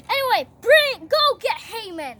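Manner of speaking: brisk royal order. Acted. King sending guards for Haman, loud order. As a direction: call out for a servant.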